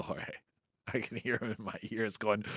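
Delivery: ordinary delivery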